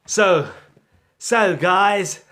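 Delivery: put-on loud, halting try at an American accent; botched American greeting voice; failed American accent. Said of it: "that's not American".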